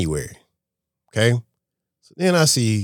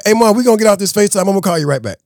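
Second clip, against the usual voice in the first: hurried sign-off; 11 semitones higher, 8 dB louder, faster than usual. Retold or imitated. Hurrying off the call.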